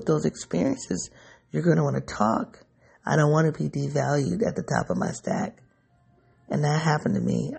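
usual speaking voice

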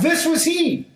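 This was he cried out high. Shown as a demonstration. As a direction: call out This was he, pitched high. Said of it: "cried out".

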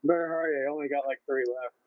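normal talking voice

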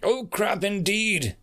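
high, slow, ringing pronouncement; ominous echo. Acted. Cloaked reaper echoes the dead soldier, ominous. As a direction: throw the words back grandly, slowly.

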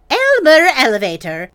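loud, sharp call of his name; stern scolding mother. Acted. Angry mother scolds son.

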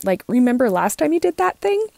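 high, pleading inner voice; inner voice of emotions. Retold or imitated. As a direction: voice the worried emotions. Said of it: "our emotions are saying".

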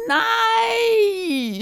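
very high, long-held shriek; anguished scream. A narrator's reading. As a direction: scream the word out in horror. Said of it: "skreg Hyggen".

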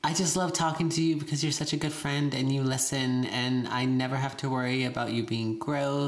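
lightly voiced Valley Girl, high; a hint of a gushing Valley Girl. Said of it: "Why do you have your Valley Girl voice on?".

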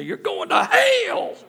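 high, drawn-out mock shout; loud, slow, hammed-up street-preacher yell; bullhorn street preacher. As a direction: holler it like an evangelist on a bullhorn. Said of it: "somebody on a bullhorn".

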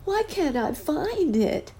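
put-on very high, slow plaintive child's voice; a small boy calling out, lost; high drawn-out child's plea. A narrator's reading. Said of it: "said the boy".

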